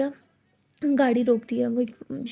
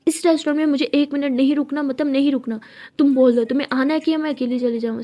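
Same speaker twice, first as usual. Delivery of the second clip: louder, hard-set ultimatum; stubborn anger; 6 dB louder than usual. Acted. Tara refuses to stay a minute longer, loud angry ultimatum.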